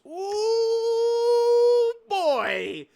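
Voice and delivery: long, high, loud whoop easing down; excited exclamation. Real, not acted. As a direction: draw out a big high "ooh", then land loudly. Reacts to the celebration timing.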